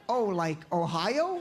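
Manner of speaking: put-on slow, clueless Jersey-accented question. Jersey kid wrongly guesses dad's homeland, slow Jersey accent. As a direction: drag it out and rise like a Jersey kid. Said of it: "they have that accent".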